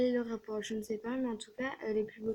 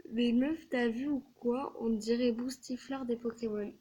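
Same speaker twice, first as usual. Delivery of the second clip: usual speaking voice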